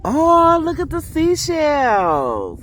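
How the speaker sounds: high, loud, slow delight; thrilled squeal over seashells; excited. Real, not acted. Spots seashells in the water.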